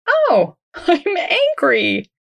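drawn-out, high "oh" of discovery; dawning realisation. Retold or imitated. Walker realising why they are out of breath, surprised realisation. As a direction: act out a slow, sudden self-discovery. Retells sudden realisation of own anger.

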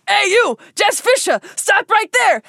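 high, loud stern call; chaser shouting. Pretend. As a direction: shout like a pursuer. Voicing the chaser.

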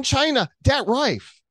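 high, loud mock Chinese accent, dat for that; a hammed-up mock Chinese accent on dat rife. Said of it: "as they say in, in China".

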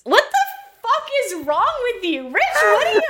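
high furious shout at Rich; outraged shout. Acted. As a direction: scream it in outrage. Wife outraged at stranger's kiss.

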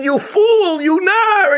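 very high, loud crying scold; furious wife. Acted. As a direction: shriek at the foolish husband. Wife beats husband with umbrella.

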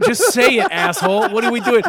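loud, high-pitched sarcastic exasperation; mock scolding. Podcaster mocking a friend's joke announcement, loudly sarcastic. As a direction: berate a friend in comic exasperation.

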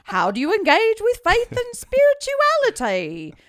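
slow, high, loud mock British interviewer; haughty British accent. Still reading the Archbishop's question in mock British accent. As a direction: stretch it out like a pompous British host. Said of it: "like, a haughty British accent".

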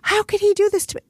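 high, whiny resentful thought; mock the aggrieved inner voice; indignant complaint in the head, pitched high. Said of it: "that voice in my brain say".

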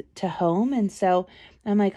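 ordinary delivery

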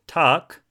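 "takk" with a long drawn vowel; long-vowel "takk", meaning roof; long wrong takk. Shown as a demonstration. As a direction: stretch the vowel of "takk", like "tahrk". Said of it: "a longer".

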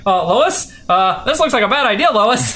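high, mock-worried voice; fretting Clark Kent. Clark scooting beside Lois, mock worry.

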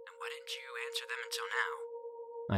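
hushed, whispered questioning; very soft, eerie whisper; eerie radio voice. A narrator's reading. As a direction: probe softly over the radio. Mysterious radio voice questions him.

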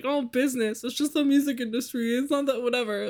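high, breezy mock shrug; dismissive. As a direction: wave it off like it's nothing. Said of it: "that's the hill I apparently am dying on".

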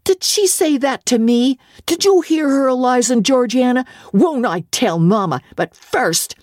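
put-on high, indignant tattling boy; spoiled boy threatening to tell Mamma; outraged boy. A narrator's reading. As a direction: whine for his sisters to witness. John outraged, calling his sisters.

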